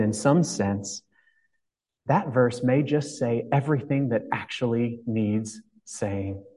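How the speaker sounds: ordinary talk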